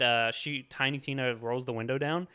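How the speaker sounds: ordinary talk, nothing marked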